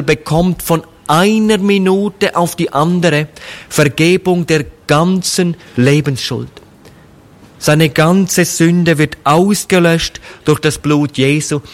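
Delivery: ordinary delivery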